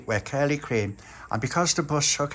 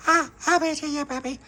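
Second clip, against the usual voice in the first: put-on much higher tiny baby voice; 14 semitones higher than usual. Baby Lucia answering the puppy in a tiny high voice. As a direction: squeak it like a toddler.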